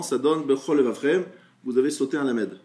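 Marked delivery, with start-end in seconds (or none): 0.4-1.2 BECHOL LEVAVREM slurred into one word, losing an L; quick, run-together. Shown as a demonstration. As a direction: rush "Bechol Levavrem" with no gap, the two L sounds merged. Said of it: "Si vous dites rapidement".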